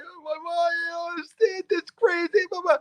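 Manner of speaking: high, thin, softened mock sobbing; hysterical. Man retelling his breakdown to his wife, mock hysterical. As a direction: imitate yourself wailing to your wife, very high. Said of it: "I'm hysterical outside".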